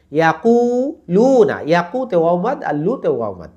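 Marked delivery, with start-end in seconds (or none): 0.1-1.6 ইয়াকুলুনা high and slow, holding কু and লু; two stretches. Shown as a demonstration. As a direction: sustain কু and লু, keep the other syllables short. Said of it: "দুইটা ওয়াও মাদ".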